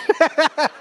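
a short, high burst of real laughter; high giggle. Real, not acted. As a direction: let a giggle escape. Laughing at cattle slip.

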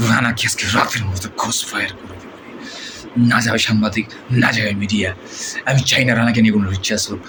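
ordinary talk, nothing marked

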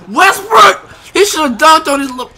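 high, loud exasperated yell; real frustrated outburst; loud outcry. Real, not acted. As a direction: shout the name out in disbelief. Reacting to opponent's dunk.

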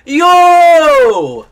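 high, long, loud holler; astonished shout. Real, not acted. Streamer shocked by the game, astonished shout. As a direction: shout it out and hold it.

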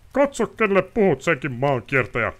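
high, loud enraged nobleman yelling; pompous noble screaming in fury; furious shout. Acted. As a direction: bellow it at the vagabond in rage. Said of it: "huusi Osterit raivoissaan".

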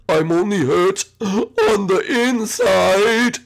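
slow, hammed-up heartbroken wail; upset. Dad tells Junior he's hurting, mock upset voice. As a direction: drag it out as if close to sobbing. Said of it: "he sounds upset".

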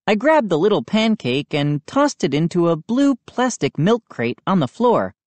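usual speaking voice

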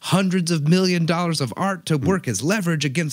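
high, loud disbelieving rant; incredulous outburst. Retold or imitated. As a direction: blurt it out in disbelief, laughing. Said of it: "And I'm like".

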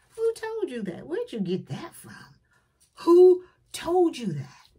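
high, loud parent's demand; exasperated parent. Retold or imitated. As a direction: drag out the last WHO like a fed-up parent. Parent questioning their kids.

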